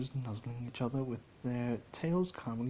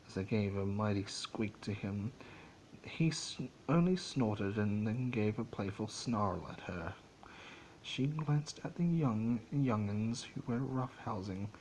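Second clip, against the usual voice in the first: plain reading voice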